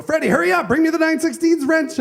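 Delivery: impatient father. Retold or imitated. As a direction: hurry the boy up, losing patience. Father impatiently repeats the request.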